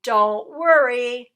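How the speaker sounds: loud, slow shout. A narrator's reading. Dog shouting back at the rat, loud. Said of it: "shouted Dog".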